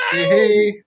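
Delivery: bright loud call to a pet. Real, not acted. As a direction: call the dog happily. Calling out to the dog.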